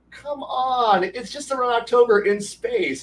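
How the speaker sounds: high-pitched, wheedling retold plea; pleading protest. Player arguing with game master for his idea, pleading. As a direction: beg the game master to give in.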